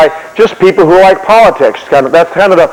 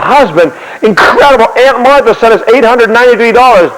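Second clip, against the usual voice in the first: higher, thrilled burst of news; excited wife; 6 semitones higher than usual. Acted. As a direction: share amazing news with your husband, pitch raised. Wife telling husband of windfall.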